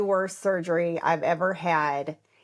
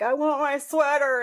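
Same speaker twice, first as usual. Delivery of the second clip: put-on higher, pitiful childlike whine; whiny plea; 7 semitones higher than usual. Patient asking her husband for the sweater, whiny. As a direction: beg for it like a miserable kid. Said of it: "I'm like".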